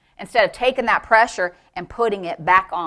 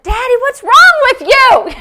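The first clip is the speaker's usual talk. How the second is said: very high, loud mock teenage dismay; sixteen-year-old daughter; 10 dB louder than usual. Daughter confronts her wild father, in mock high-pitched alarm. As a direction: imitate an appalled teenage girl. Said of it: "can you imagine a sixteen-year-old".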